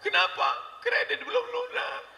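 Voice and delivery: put-on very high, wailing child voice; hammed-up tearful wail; mock crying daughter. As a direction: whine to mama in fake tears. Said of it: "anak pulang nangis".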